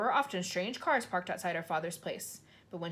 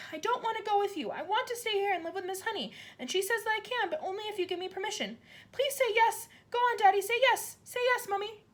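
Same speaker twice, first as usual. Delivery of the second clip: put-on high, loud begging child; a girl begging her parents for permission; pleading girl; 13 semitones higher, 4 dB louder than usual. A narrator's reading. Child begs parents to agree.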